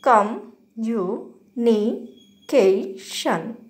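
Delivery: communication broken into separate syllables, com, you, ni, ke, shan; each syllable set apart with a pause. Shown as a demonstration. Teacher counting out the five syllables of communication, syllable by syllable. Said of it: "have five syllables".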